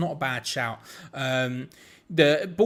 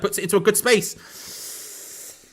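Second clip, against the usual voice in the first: rising, louder, drawn-out call; excited commentary; 5 semitones higher, 4 dB louder, much slower than usual. Real, not acted. Commentator calling a ball into space, excited. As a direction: stretch it out as the chance opens. Live chance building in match.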